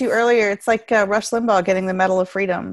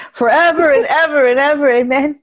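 mock preacher; 3 semitones higher, 5 dB louder than usual. Mock liturgy.

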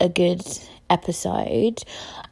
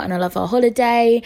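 ordinary talk

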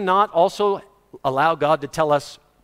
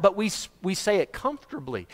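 usual speaking voice

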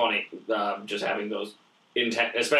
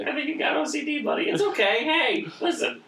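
much higher, soothing fake therapist; mock consoling voice; 14 semitones higher than usual. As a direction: pat him on the head, high and sweet. Mock consoling his friend.

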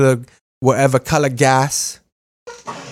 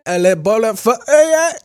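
high mock British babble; squeaky hammed-up British sputter; ridiculous fake British accent; mock British gibberish; 10 semitones higher than usual.

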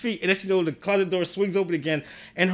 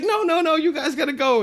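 high, loud frantic protest; 10 semitones higher, 3 dB louder than usual. Retold or imitated. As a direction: shoo them out in a panic. Girl urging parents out.

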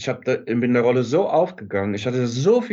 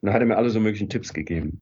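ordinary talk, nothing marked

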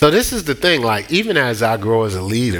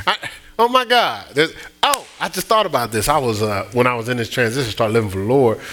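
usual speaking voice

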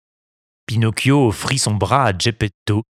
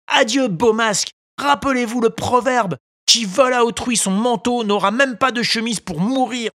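high voice, preachy proverbs; a high little puppet moralizing smugly at the beggars; scolding puppet; 13 semitones higher than usual. A narrator's reading. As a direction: puppet lecturing the crooks. Pinocchio lecturing beggars.

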